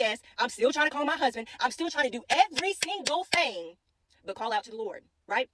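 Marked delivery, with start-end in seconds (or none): 2.3-3.8 "every single thing" broken into separate stressed syllables; emphatic syllables. Real, not acted. Driver stressing she tried everything, "every single thing" syllable by syllable. Spells out her exhausted options.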